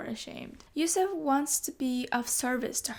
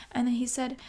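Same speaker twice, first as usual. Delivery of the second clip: usual speaking voice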